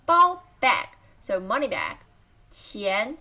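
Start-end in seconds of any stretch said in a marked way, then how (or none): 2.5-3.2 钱 rising in pitch; careful rising tone on 钱; second tone. Shown as a demonstration. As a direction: lift the pitch through 钱. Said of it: "second tone".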